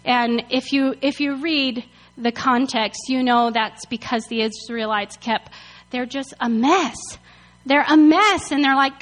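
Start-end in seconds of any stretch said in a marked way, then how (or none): none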